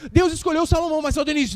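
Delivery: ordinary delivery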